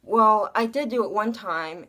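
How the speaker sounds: usual speaking voice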